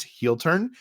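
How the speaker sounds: ordinary talk, nothing marked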